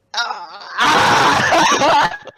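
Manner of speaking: loud laughing-crying wail; long, exaggerated cry of despair. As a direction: wail in mock agony. Despair at the retold complaint.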